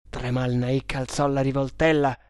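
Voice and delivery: plain reading voice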